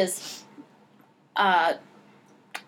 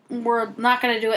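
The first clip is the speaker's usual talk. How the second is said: normal talking voice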